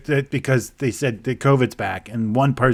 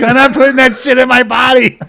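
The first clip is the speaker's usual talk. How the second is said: higher, louder hammed-up refusal; mock defiant shout; 11 semitones higher, 8 dB louder than usual. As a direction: shout it like a stubborn partier. Guy refuses vaccine.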